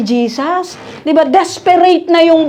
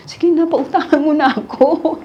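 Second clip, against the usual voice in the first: mock pleading borrower; 3 semitones higher than usual. Borrower coaxing a lender for money, mock pleading. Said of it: "nasubukan nyo na bang makiusap".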